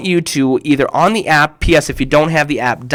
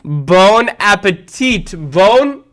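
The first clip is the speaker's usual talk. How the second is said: drawn-out "Bon appetit"; slow punchline; 4 semitones higher, slower than usual. Shown as a demonstration. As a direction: savour "Bon appetit" slowly. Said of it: "You get it?".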